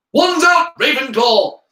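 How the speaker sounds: high, loud rallying cry; shout. A narrator's reading. Michael Corner feeling left out, shouting. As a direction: shout your house to arms. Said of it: "shouted Michael Corner".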